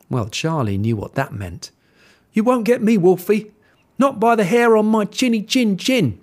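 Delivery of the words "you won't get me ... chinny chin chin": defiant pig voice. A narrator's reading. Pig defies wolf.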